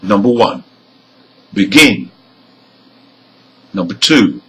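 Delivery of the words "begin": "begin" stressed on GIN, weak start; last-syllable stress. Shown as a demonstration. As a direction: lean on the end of "begin". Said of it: "have stress on the".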